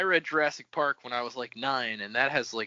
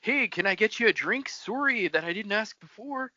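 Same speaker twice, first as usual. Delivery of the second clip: overly polite Canadian; 7 semitones higher, 4 dB quieter than usual. Retold or imitated. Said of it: "They're so polite".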